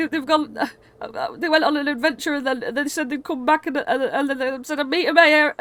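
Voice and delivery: high, stammering, flustered explanation; panicked father. Acted. Father explaining where his kids went, panicked stammering. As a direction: stumble over repeated words in a high, anxious voice. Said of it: "absolutely shitting himself".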